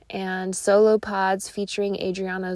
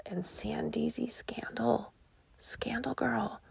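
gossipy whisper, fading softer; 11 dB quieter than usual. Real, not acted. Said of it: "whispering in your ear".

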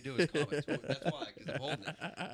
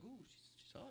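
whisper; 11 semitones higher, 19 dB quieter than usual. Retold or imitated. Reacting to girl's email.

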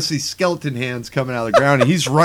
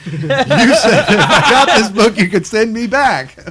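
laughing mock demand; 7 semitones higher, 5 dB louder than usual. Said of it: "hold it right there".

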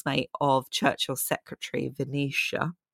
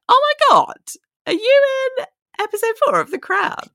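excited fan; 7 dB louder, faster than usual. Retold or imitated. Fans' excited messages acted out.

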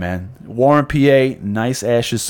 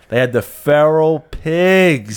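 dramatic reveal; 4 semitones higher, 3 dB louder, much slower than usual. Retold or imitated. Big reveal of rare cigars.

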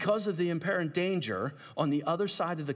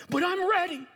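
fervent declaration; 14 semitones higher, 4 dB louder than usual. Acted. Declaring readiness.

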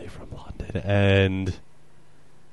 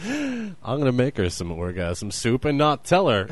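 ordinary talk, nothing marked

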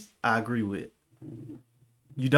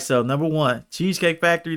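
normal talking voice

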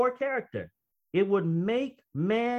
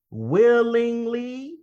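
WILLINGLY drawn out long, higher and louder; emphatic repeat; 5 semitones higher, 5 dB louder, much slower than usual. Real, not acted. Preacher repeating the key word to the congregation, WILLINGLY long and loud. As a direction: stretch out WILLINGLY, pitched up and forceful.

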